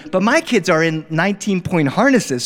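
plain reading voice

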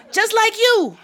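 put-on high, cheeky child's voice; cheeky comeback. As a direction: toss it back as a sassy kid.

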